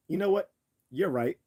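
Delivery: high, loud mock-cheerful agreement; mock agreeing griever. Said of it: "They'd be like".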